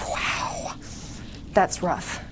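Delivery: barely voiced, soft wow; breathy aside. Reading her own confession, a soft hushed aside. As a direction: let it out under your breath, deflated. Reacting to seeing it written.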